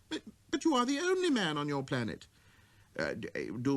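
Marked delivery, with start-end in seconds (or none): 0.1-2.3 put-on raised childlike voice; a small boy musing to himself; little prince.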